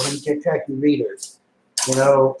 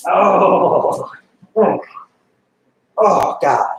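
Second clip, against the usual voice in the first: groan of disgust; 3 semitones higher, 3 dB louder, much slower than usual. Real, not acted. Groaning at hated Dazzler card.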